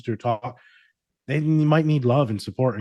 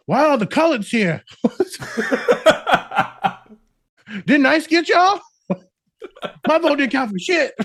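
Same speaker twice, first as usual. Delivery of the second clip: mock bigot voice; 5 dB louder than usual. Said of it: "Matt's like".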